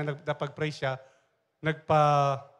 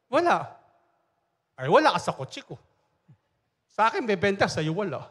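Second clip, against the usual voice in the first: louder, smug brag about the car; boastful classmate; 4 semitones higher, 5 dB louder than usual. Retold or imitated. Classmate boasting to the pastor, louder and smug. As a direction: show off, rubbing it in.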